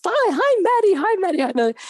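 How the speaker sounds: very high, chirpy retold hellos; effusive mock greetings. Crew suddenly greeting the new kid, high-pitched imitation. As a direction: imitate a crowd eagerly calling hello.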